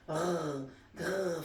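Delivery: low growl; pig growl. Acted. Angry pig growls at the monkey, low growl. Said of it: "faisait le cochon".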